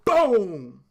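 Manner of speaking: a loud punchy boom. Retold or imitated. John landing the knockout punch, a loud boom. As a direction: slam the word out.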